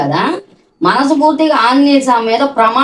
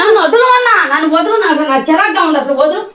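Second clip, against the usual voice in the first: irritated protest; 6 semitones higher than usual. Real, not acted. Mother shaking son off, irritated.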